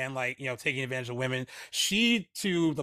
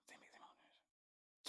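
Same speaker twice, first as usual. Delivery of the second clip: whispering; 34 dB quieter than usual. Real, not acted. Repeats the tagline to herself.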